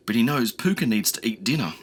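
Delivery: plain reading voice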